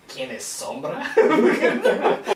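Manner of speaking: mock Spanish quote. Quoting a game line.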